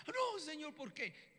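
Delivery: high-pitched, dismayed plea; alarmed protest. Acted. Ezekiel told God will take his wife, alarmed protest. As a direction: cry out in acted dismay. Acted prophet protests wife's death.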